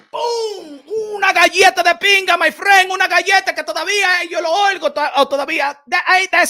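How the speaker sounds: high, loud, gleeful bragging; excited boast. Real, not acted. Storyteller brags to the audience about his smack, excited shout. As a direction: relive the best smack ever.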